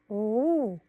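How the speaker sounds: usual speaking voice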